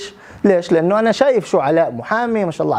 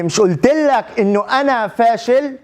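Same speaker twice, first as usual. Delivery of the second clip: retold outburst; 6 semitones higher than usual. Friend snapping at interviewer over talk of his success, retold outburst. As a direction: imitate an exasperated friend crying out. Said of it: "صرخ".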